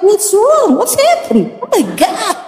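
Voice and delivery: high, startled voice of a hotel porter; playing the shocked porter; alarmed bellboy. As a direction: gasp out the porter's worry in English. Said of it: "petugas yang bawa koper".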